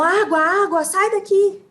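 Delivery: interpreter's mild mock yell; retold yelling. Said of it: "outras pessoas gritavam".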